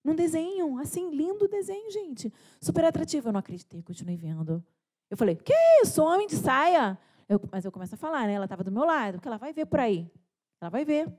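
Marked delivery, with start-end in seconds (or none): 5.3-7.0 loud, high-pitched cry of disbelief; shocked outburst. Retold or imitated. Mother retelling her reaction to the cartoon, shocked. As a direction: reenact a scandalized, high yelp. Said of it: "Eu fiquei tão desesperada".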